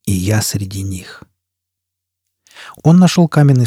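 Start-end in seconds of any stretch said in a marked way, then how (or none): 0.0-1.2 slow, low and hushed; forced out. A narrator's reading. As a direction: push the words out heavily. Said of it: "выдавил он".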